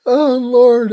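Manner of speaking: real laughing dismay, loud and high; exasperated cry. Real, not acted. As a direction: cry out at the awful choice. Dreading a hard question.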